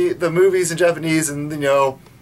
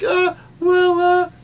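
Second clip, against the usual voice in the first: high, whimpering dumb stammer; clueless new Serena; 11 semitones higher, much slower than usual. Retold or imitated. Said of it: "she's just like".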